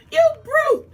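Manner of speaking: high, loud cry of outrage; brave damsel yelling. Acted. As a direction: shriek it at the brute. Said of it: "a brave damsel burst".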